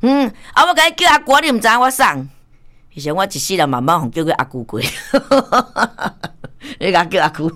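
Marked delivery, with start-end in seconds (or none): 0.0-2.3 high, loud mock caller voice, higher and louder; acted phone caller. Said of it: "伊讲你送". 4.8-6.7 laugh. Real, not acted. Laughing at own story.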